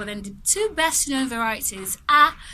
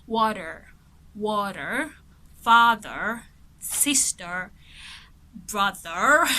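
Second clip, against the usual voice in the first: rhotic American accent with a clear R on "water" and "brother"; lightly put-on American accent, R sounded at the ends; 3 dB louder, much slower than usual. Shown as a demonstration. Teacher shows how an American says "water", light American accent. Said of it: "So an American person will say".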